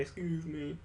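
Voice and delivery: ordinary delivery, nothing marked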